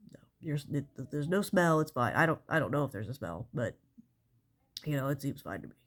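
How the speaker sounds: normal talking voice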